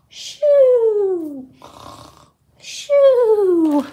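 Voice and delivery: high, loud shoo falling off. Pretend. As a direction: shoo the critters away. Shooing to wake the bear.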